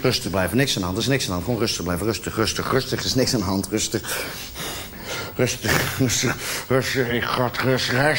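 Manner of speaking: low, soft self-talk telling himself to stay calm; low, soft voice forcing himself to stay calm. Acted. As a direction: murmur rustig over and over to steady your nerves. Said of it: "zeg ik".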